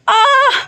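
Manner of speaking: high sharp cry of pain; pained high aah. Acted. Ketaki in labor pain.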